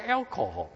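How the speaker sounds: alcohol in standard American English; corrected pronunciation. Shown as a demonstration. Pastor shows congregation his corrected alcohol. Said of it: "我矫正我的发音".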